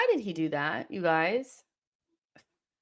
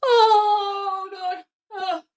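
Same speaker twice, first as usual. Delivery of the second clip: anguished cry; 10 semitones higher, 6 dB louder, much slower than usual. Real, not acted. Anguished reaction to near goal.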